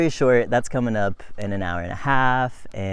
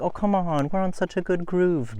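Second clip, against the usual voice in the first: higher-pitched, protesting inner voice; mock pleading; 8 semitones higher than usual. Cyclist resisting a break for the overheating baby, mock pleading. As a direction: plead to keep going, imitating your own impatience. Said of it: "And I'm just like".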